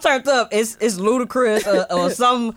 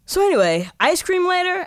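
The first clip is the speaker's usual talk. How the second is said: casual offer, lifting high at the end; breezy switch; 10 semitones higher than usual. Retold or imitated. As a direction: imitate someone suddenly carefree after yelling. Instant switch from yelling to casual.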